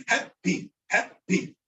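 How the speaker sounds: happy split as hap-pee, both P's heard; doubled consonant. Shown as a demonstration. Teacher demonstrating the shadda, happy with double P. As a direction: stop on the first P of happy, release the second. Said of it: "you're gonna say both of them".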